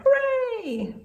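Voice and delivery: high happy hooray; bright storybook cheer. Acted. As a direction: cheer it for the sheep.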